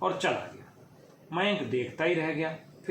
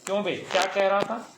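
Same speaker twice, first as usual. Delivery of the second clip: loud, higher, sharp-voiced demand; sharp angry; 6 semitones higher, 6 dB louder than usual. A narrator's reading. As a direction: snap at him with a rolling pin raised. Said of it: "तीखे स्वर में".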